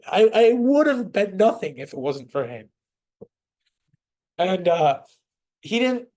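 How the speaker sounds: high, strained voice on the edge of tears; fighting back tears, pitch cracking up; emotional, voice breaking. Real, not acted. Player telling the table about his father figure, voice breaking. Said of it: "I'm getting emotional".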